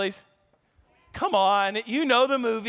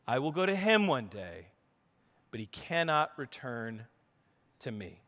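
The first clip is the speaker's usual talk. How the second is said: scripture reading; 6 semitones lower, 3 dB quieter than usual. Acted. Preacher reading Second Samuel to the church, a soft solemn reading. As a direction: read it gently, letting it sink.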